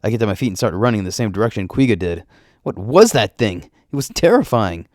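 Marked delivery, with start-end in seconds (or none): 2.6-4.9 higher, stressed shock at a monster; stunned after a narrow escape; alarmed. Acted. Narrator's alarm after fleeing a monster.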